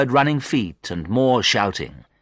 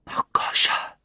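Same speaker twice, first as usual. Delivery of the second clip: weak whisper of a name; barely manage to whisper it. A narrator's reading. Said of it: "I managed to whisper".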